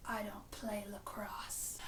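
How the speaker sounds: quiet quoted line. Retold or imitated. Ziva to the son who swung at her, whispered slowly. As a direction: breathe it out, slow and dry. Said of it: "And then she says".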